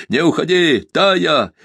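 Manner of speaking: high, loud cry through a door; desperate call. A narrator's reading. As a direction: shout after her, not holding back. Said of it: "он крикнул, не сдерживая голоса".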